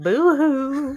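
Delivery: mock sobbing boo-hoo; fake crying. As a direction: whine it like a pretend cry. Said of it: "I hate the environment I created".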